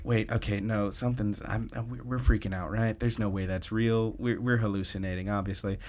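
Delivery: ordinary talk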